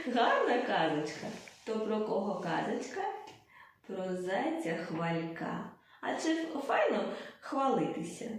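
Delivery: usual speaking voice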